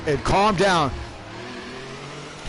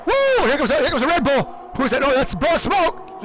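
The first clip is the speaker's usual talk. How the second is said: high whoop into excited call; excited play-by-play; 6 semitones higher than usual. Real, not acted. Commentator spotting a car coming through, excited.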